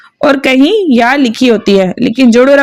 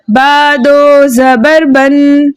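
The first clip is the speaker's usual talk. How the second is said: slow, level chant with stretched syllables; spelling-out recitation; much slower than usual. Shown as a demonstration. Teacher reads spelling and blend together for pupils, slow chanted drill. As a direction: draw out each syllable and hum the final n. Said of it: "जोड़ो रवा के साथ पढ़ते हैं".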